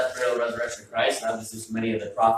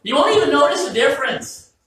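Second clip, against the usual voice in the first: exaggerated mock promise; 8 dB louder than usual. Mocking the cook's promise.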